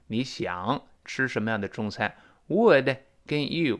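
ordinary talk

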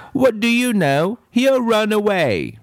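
put-on high, insistent little-girl voice; a stubborn girl contradicting her teacher; bratty child insisting she's right; contrary girl.

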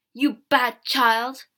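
slow, loud scolding; angry shout. A narrator's reading. Mother scolds child for broken bottle, shouting. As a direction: scold the child loudly, stretching each word. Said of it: "my mother shouted".

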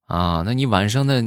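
ordinary talk, nothing marked

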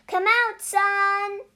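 high, sing-out shout. A narrator's reading. Cow calls the sun from behind the barn, high shouting. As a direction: call out to someone hiding. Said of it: "shouted Cow".

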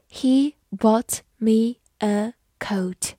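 slow, word-by-word dictation with gaps; measured teacher's model, pausing between words. Shown as a demonstration. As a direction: say each word separately, slowly and clearly.

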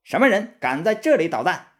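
high, loud challenge; shouting. A narrator's reading. Red Boy shouts at monks outside his cave, loud challenge. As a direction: bellow it out at the intruders. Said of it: "走出洞去高喊".

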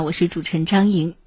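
usual speaking voice

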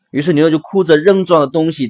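ordinary talk, nothing marked

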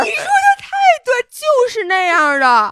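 laughing through the words; high, loud laughing agreement. Real, not acted. Laughing agreement about fish lips.

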